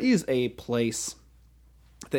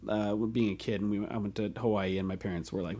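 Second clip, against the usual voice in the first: ordinary talk, nothing marked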